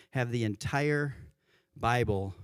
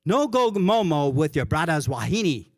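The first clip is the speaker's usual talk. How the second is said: "No go momo with your brother's wahine" in a louder, amused Hawaiian Pidgin lilt; 4 semitones higher, 5 dB louder than usual. Retold or imitated. Pastor reading the funny commandment to the congregation, Hawaiian Pidgin lilt. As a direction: relish the Pidgin words like a joke. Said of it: "This one really made me laugh".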